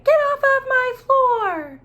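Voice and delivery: high, loud scolding mom voice; mother scolding. Acted. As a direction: shriek it like an angry mother. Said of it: "She would say".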